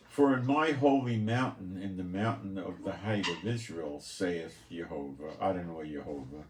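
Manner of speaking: lifted, declaiming bible voice; solemn scripture reading. Acted. Preacher reading Ezekiel to his listeners, solemn declaiming. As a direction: proclaim each phrase like holy writ. Reads scripture aloud to congregation.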